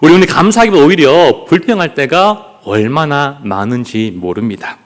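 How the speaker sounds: ordinary talk, nothing marked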